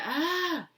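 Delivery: high, loud, drawn-out "ah"; mock scream, rising high then falling; demonstrated "ah" cry. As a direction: kid acting out a startled cry. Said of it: "It wasn't a scream".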